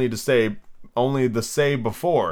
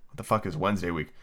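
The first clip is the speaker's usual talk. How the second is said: ordinary delivery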